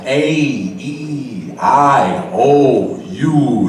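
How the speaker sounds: vowels stretched wide and exaggerated; A, E, I, O, U with the mouth stretched wide; over enunciating. Shown as a demonstration. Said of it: "stretch and exaggerate your mouth".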